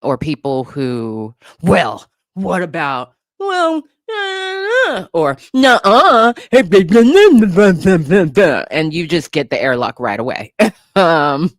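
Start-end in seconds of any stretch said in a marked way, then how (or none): 1.6-5.1 high-pitched mock troll whine; whiny mock objection, pitched way up. 5.6-8.7 loud, high mock bickering; exaggerated, loud jeering imitation; mocking trolls. Mimicking trolls arguing back.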